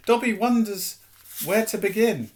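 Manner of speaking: a nervous servant with a secret; hesitant house elf. Acted. Dobby struggles to explain.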